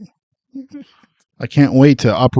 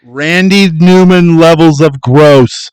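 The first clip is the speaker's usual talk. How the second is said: slow, loud, higher put-on singer voice; [name] impression; 5 semitones higher, 7 dB louder, much slower than usual.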